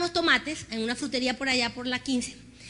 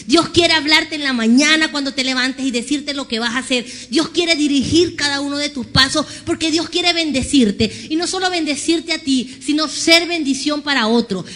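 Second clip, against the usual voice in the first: preaching at full force; 5 dB louder than usual. Acted. Preacher closing the message to the church, loud. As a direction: rise and proclaim to the congregation. Preaching climax over music.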